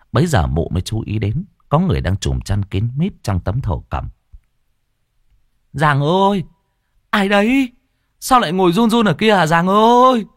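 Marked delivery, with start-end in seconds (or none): 5.7-10.3 high, loud startled cry; panicked woman's voice; alarmed woman. Acted. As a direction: call out in fright to the stranger. Woman alarmed at trembling figure.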